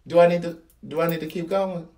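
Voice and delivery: ordinary talk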